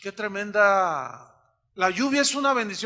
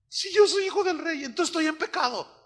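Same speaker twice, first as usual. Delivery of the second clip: high questioning voice; 9 semitones higher than usual. Retold or imitated. Troubled believer voiced.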